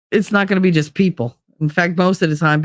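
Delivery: usual speaking voice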